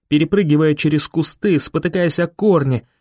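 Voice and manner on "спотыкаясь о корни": usual speaking voice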